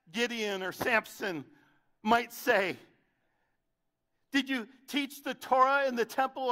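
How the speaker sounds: loud, high sermon build; preaching at full force. Acted. Preacher to the church, questioning loudly. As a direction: preach it hard to the congregation.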